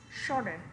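"Shorter" with SHOR stressed, ter falling away; stress on first syllable. Shown as a demonstration. Teacher giving students another example, first-syllable stress on "Shorter". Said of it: "đọc nhấn lên một chút".

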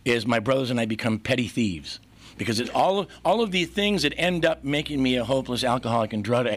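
ordinary talk, nothing marked

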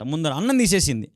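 ordinary talk, nothing marked

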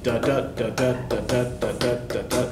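"da, da" beats tapped out softly and evenly, like a heartbeat; galloping iamb rhythm. Shown as a demonstration. Teacher showing the class the iamb beat, soft steady chanting. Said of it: "Iams have this kind of galloping rhythm".

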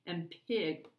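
put-on lively read-aloud for children; story narration. A narrator's reading. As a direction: read it like a bedtime picture book. Reads picture book aloud.

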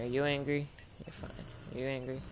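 ordinary delivery

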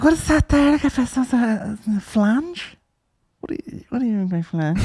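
high, slow mock-puzzled whine; mock her fussy questions in a high voice; mocking fussy sister. Mocking sister quizzing menu, high.

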